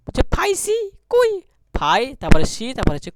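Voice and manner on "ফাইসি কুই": ফাইসি কুই in a high voice as "phai-si kui"; playful spelling pronunciation. Shown as a demonstration. Teacher amusing the class while drilling, ফাইসি কুই said high.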